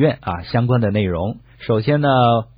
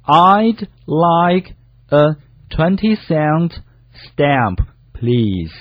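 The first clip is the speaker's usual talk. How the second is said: high, very slow word-by-word repeat; slow practice reading; 5 semitones higher, much slower than usual. Shown as a demonstration. Co-host repeats the sentence for learners, very slowly. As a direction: take it one drawn-out word at a time. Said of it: "可以慢点读啊".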